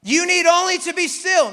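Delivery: loud high declaration; Moses' last line. Retold or imitated. Moses to the Israelites, a loud high declaration. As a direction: land the line with full conviction. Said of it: "'Cause he says".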